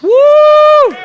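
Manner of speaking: high, loud gloating whoop; triumphant shout. Real, not acted. Pastor teasing a Steelers fan in the room, loud gloating whoop. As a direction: crow over the rival fans. Taunting Steelers fans with a Ravens cheer.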